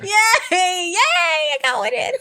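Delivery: high, loud gleeful cheer breaking into laughter; laughing cheer. Real, not acted. Proud of her pun, she cheers and laughs in triumph. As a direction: crow with delight, laughing through it.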